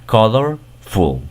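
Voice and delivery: "colorful" said slowly; slow English word. Shown as a demonstration. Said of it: "Si ya sabes pronunciar esta". ("colorful" despacio, en inglés)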